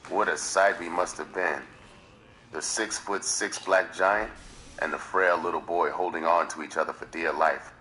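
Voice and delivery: plain reading voice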